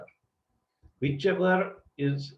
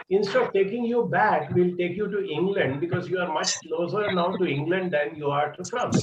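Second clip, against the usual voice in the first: higher, louder reassuring call; boat crew voice; 5 semitones higher, 4 dB louder than usual. Retold or imitated. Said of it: "and said".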